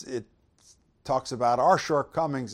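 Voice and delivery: normal talking voice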